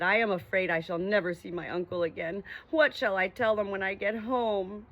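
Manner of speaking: usual speaking voice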